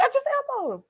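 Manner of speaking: high put-on voice playing dumb; mock innocent heel. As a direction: squeak it out like a guilty wrestler. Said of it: "He was like".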